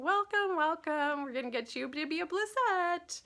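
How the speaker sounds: high, loud gushing greeting; excited welcome. Real, not acted. Host greeting a first-time viewer, high excited welcome. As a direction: sing out a big squealing welcome.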